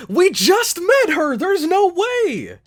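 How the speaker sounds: high, wailing disbelief; distressed protest. Real, not acted. Viewer refuses to lose best girl so early, distressed protest. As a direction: cry out in protest, stretching the ending.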